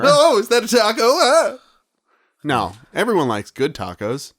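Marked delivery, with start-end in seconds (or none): 0.0-1.6 mock excited delight. Acting out taco lover.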